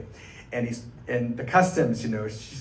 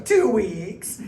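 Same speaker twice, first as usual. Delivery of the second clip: robotic two weeks; 7 semitones higher, 3 dB louder than usual. Retold or imitated. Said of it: "add that to my repertoire".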